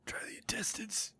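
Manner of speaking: hushed whispered suggestion; breathless whisper. Real, not acted. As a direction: whisper it so nobody hears.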